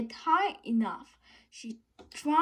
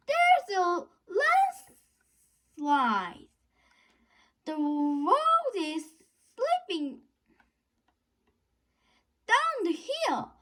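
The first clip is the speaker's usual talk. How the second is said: high, louder alarmed call; alarmed shout; 7 semitones higher, 3 dB louder than usual. A narrator's reading. Lisa warning friends about the landslide. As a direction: warn them of the danger. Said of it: "shouted Lisa".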